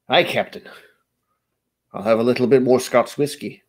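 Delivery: put-on Scottish accent; Scotty. Streamer showing his default Scottish accent, in hearty brogue. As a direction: play a starship engineer with a Scottish burr. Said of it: "that's the Scottish accent I kinda default to".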